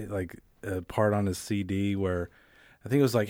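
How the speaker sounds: normal talking voice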